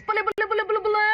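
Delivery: high, hammed-up "Bulleh Bulleh" chant. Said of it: "when we had to show Punjabis".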